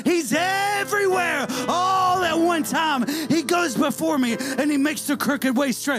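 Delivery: rising, shouted preaching; preaching at full force. Acted. Preacher to congregation, shouting with rising fervor. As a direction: drive it home to the congregation.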